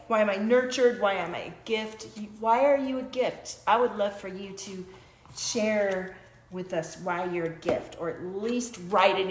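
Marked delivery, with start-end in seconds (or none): none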